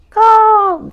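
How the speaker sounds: high, loud crow call; crow caw. Acted. Imitating a crow.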